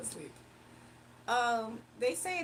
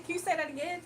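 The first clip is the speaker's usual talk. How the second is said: normal talking voice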